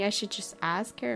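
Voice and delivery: ordinary delivery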